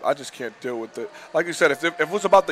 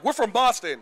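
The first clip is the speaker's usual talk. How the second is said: fake Boston accent; 8 semitones higher than usual. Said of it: "tried so hard to be like".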